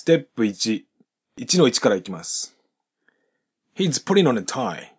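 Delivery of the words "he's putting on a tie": "putting on" linked, G dropped, N joined to on; linking demo. Shown as a demonstration. Teacher models linking, "putting on" without G. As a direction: say "putting on" as one run, no G sound. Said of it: "ここでのポイントは、現在進行形のGが発音されずに".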